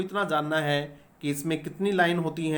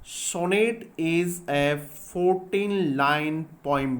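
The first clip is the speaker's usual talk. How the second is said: very slow, word by word for notes; spaced-out dictation pace; much slower than usual. Shown as a demonstration. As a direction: dictate it slowly so they can write. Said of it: "ये बात आपको याद रखनी है".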